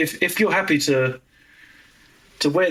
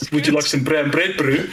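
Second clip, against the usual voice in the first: mock South African accent; imitated South African offer of food. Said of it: "trying to do the South African accent".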